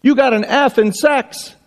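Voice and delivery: put-on high, triumphant child's voice; little girl. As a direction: announce it like a kid who caught grandma out. Girl reads punchline.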